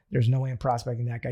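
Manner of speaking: usual speaking voice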